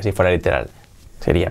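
ordinary delivery

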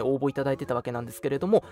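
ordinary talk

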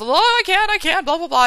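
high, loud mock whine of excuses; mock whiny excuses. Mocking the client's whiny excuses.

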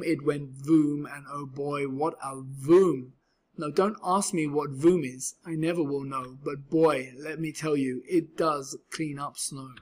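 usual speaking voice

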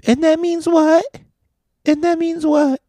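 very high, mocking falsetto; her voice. Woman talks back to gym worker, mock falsetto. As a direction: imitate her in an exaggerated squeaky voice. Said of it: "her voice in this voice".

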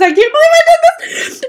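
high, loud, half sobbing laughter; cry-laughing. Real, not acted. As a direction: wail through helpless laughter. Cry-laughing at own embarrassment.

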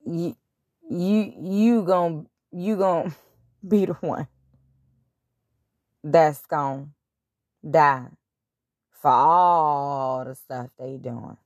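slow, low, half-laughing God voice; a hint of a solemn God, slow; lightly voiced God. God answering Jesus on the cross, slow and low. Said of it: "And God said".

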